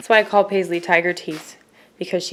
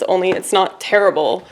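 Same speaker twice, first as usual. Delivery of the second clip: ordinary talk, nothing marked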